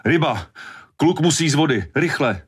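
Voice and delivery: high, urgent yelled warning; urgent shout. A narrator's reading. Hooper shouts to Brody to get the boy out, urgently. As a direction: call it out as if across the waves.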